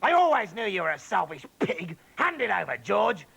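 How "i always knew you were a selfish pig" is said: high, loud mocking accusation; louder, higher sneering rant; gloating scold. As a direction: jeer at the selfish boy. Grandma accuses George, gloating.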